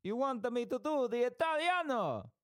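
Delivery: very high, hammed-up Italian accent; sing-song mock Italian, stretched ending. Shown as a demonstration. Host taking up the suggested accent, hammed-up Italian accent.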